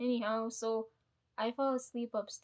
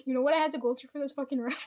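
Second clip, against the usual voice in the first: louder, fast, laughing-through exasperation; mock furious rant; 3 semitones higher, 8 dB louder, faster than usual. Telling her aunt off over the rice, fast and hammed-up angry. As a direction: fire it off in fake outrage. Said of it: "I was so mad".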